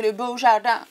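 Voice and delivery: mock English accent on Beau Jardin. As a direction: say the French name as an English speaker would. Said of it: "faut le dire avec l'accent anglais".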